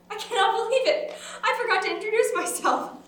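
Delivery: high, loud, embarrassed gush; flustered exclamation. Acted. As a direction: exclaim at your own forgetfulness. Flustered at forgetting her introduction.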